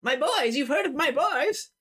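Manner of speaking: high-pitched, urgent mother's voice; anxious mother. Acted. Mother asks stranger about her boys, urgent high voice. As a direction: seize on the news about the boys. Mother hears news of sons.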